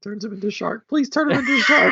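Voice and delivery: giddy high-pitched plea; excited pleading. Pretend. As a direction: beg for it in a high, rushed voice. Excitedly begging for shark plot twist.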